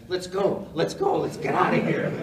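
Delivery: loud, urgent retold call. Cameraman desperate to leave the room, loud urgent call.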